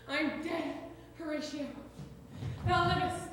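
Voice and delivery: normal talking voice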